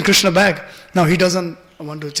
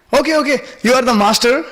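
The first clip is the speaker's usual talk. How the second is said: lightly acted concession, pitched up; conceding gopi; 6 semitones higher, 4 dB louder than usual. Elderly gopi yielding to little Krishna, lightly acted. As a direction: give in to the child, voice raised.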